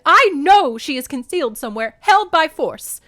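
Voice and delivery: loud, high, emphatic certainty; insistent. A narrator's reading. Mother insists her daughter is captive, forceful. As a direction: insist forcefully, slowing at the end. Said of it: "Demeter insisted".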